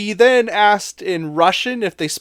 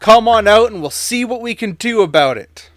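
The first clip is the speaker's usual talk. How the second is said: loud, raised-pitch call; retold shout, louder and higher; shouted quote; 5 semitones higher, 7 dB louder than usual. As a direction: project it as if calling to someone inside. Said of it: "And he shouted, I quote".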